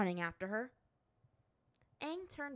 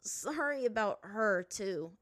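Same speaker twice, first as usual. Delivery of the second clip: lightly amused apology; grinning; 3 semitones higher, 8 dB louder, slower than usual. A narrator's reading. Aang excusing Azula to the chief, grinning. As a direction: apologise through a grin. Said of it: "said with a grin".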